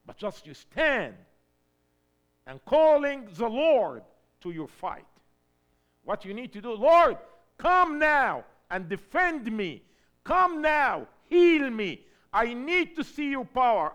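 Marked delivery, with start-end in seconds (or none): 2.4-13.9 fiery preached prayer. Acted. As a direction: cry out the prayer for the congregation.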